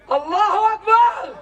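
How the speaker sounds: high, loud cry of Allahu Akbar; a rally cry over the crowd; ecstatic cry. Acted. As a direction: throw it out high and full. Chanting cry in protest poem.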